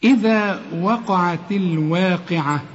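Quranic recitation. Shown as a demonstration. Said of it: "طلع لسانك في الذال".

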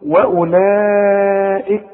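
"وأولئك" with the vowel stretched four counts; long madd. Shown as a demonstration. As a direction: drag out the madd in "وأولئك" very slowly. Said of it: "بمدها أربع حركات".

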